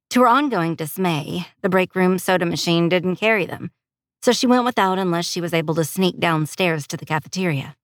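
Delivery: plain reading voice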